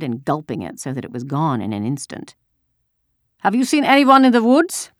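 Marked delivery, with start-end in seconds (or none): 3.4-4.9 high, loud soldier's demand; barked question. A narrator's reading. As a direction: bark it like an interrogating soldier. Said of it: "the soldier barked the question at her".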